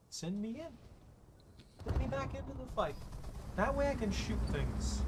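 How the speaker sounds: high, very slow and soft; hammed-up eager soldier; mock soldier voice. Mock heroic soldier line.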